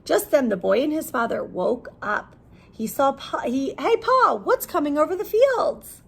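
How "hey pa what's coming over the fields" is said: put-on high, eager boy's call; young boy calling. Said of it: "boy calling to father".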